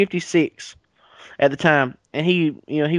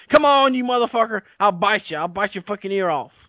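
snarling taunt; 5 semitones higher than usual. Pretend. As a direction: snap it out like a furious old brawler. Jackson's cut-off mouth taunting Roosevelt.

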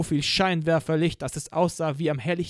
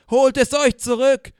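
much louder and higher; thundering rebel cry over a PA; 9 semitones higher, 9 dB louder than usual. Acted. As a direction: blast it out as a battle cry. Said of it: "donnerte die P A".

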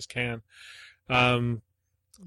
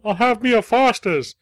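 loud, much higher mock Australian accent; horrible Australian accent; 11 semitones higher, 9 dB louder than usual. Mocking Crocodile Dundee fans in a loud, high fake Australian accent. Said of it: "speaking in a horrible Australian accent".

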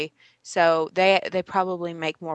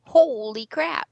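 stunned, drawn out; slow amazed holy crap; 5 semitones higher, slower than usual. Real, not acted. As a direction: gasp it out slowly in awe. Reacting to more web updates.

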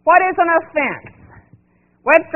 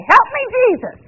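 put-on much higher frightened cry of a boy; child's cry; 10 semitones higher, 4 dB louder than usual. Grandson crashing his scooter, high panicked cry. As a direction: yelp it like a kid losing control.

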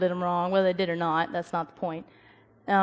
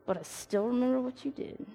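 grudging aside; 3 semitones higher, 9 dB quieter than usual. Pretend. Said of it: "you're like".

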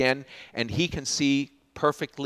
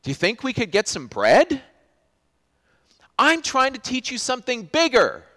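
much higher and louder, pleading frustration; teacher who can't get through; frustrated Jesus; 9 semitones higher, 7 dB louder than usual. Retold or imitated.

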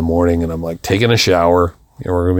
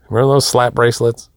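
ordinary delivery

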